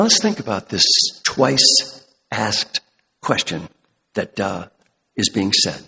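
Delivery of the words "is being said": ordinary talk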